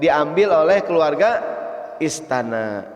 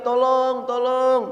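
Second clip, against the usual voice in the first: mock cry for help; 8 semitones higher, slower than usual. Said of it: "Hampir saja ibunya teriak".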